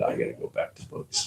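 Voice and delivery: soft unvoiced whisper, quick; hushed, hurried whisper; whispered aside. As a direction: breathe it like a confession. Retelling his own decision.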